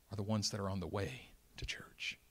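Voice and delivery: soft breathy whisper; whispered point. Acted. Preacher whispering the lesson to the congregation. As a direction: drop to a secret.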